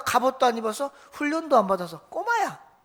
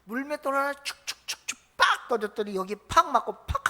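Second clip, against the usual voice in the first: sling sound effects. Pretend. Pastor acting out the sling to the congregation, playful sound effects. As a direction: sound effects of whirling and hitting.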